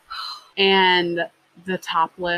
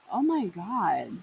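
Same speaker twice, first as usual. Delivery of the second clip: slow sloth-like reaction; 3 semitones lower, 8 dB quieter, slower than usual. Retold or imitated. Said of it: "moves like a sloth".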